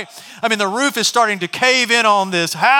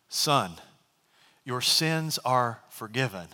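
lower, softer, slow and gentle; tender words; 5 semitones lower, 8 dB quieter, slower than usual. Acted. Jesus to the paralyzed man, soft and tender. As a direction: speak it kindly to the lying man.

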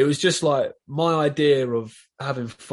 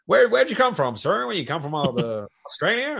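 mock Texan voice, much higher and louder; imitating a Texan; 6 semitones higher, 3 dB louder than usual. Texans quizzing a Londoner about his accent, mock high imitation. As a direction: play the puzzled local asking where he's from, pitched way up. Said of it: "They, they'd be like".

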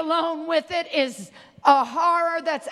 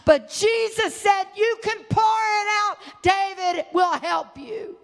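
raised, ringing sermon cry; impassioned preaching; 4 semitones higher than usual. Real, not acted. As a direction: preach it out with force, higher.

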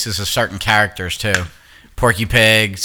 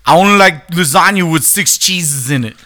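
Tracy Morgan; 5 semitones higher than usual. Acted. Host doing his favorite celebrity voice, hammed-up impression. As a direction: burst into an excitable comedian impression. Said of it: "Tracy Morgan".

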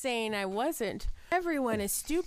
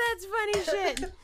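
usual speaking voice

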